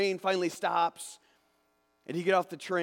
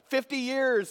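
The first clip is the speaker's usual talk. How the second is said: ordinary delivery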